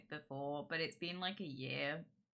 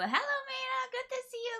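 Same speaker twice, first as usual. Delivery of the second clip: high singsong greeting. Acted.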